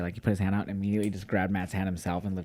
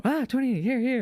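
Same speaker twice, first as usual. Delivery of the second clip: excited; 13 semitones higher, 3 dB louder than usual. Retold or imitated. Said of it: "she checks Johnny's coat".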